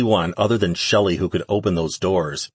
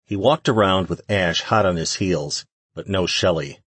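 ordinary delivery, nothing marked

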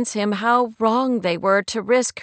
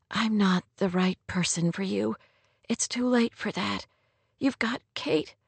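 hushed hurt, lightly acted; pained; 7 dB quieter than usual. A narrator's reading. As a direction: softly give him up, pain showing. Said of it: "couldn't keep the pain out of her voice".

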